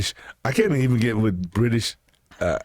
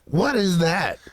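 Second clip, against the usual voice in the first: mock bafflement; 10 semitones higher than usual. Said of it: "Dude, I'm like".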